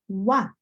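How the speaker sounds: "wa" as one open syllable, w gliding into ah; French OI sound. Shown as a demonstration. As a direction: demonstrate French oi clearly as "wa". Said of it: "O I in French is meant to sound like".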